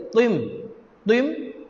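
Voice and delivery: "Tim" with a heavy, emphatic Arabic t; thick T. Shown as a demonstration. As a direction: say each "Tim" with a thick tho sound. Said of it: "Lagi tebal". ("Tim" dengan bunyi tho tebal)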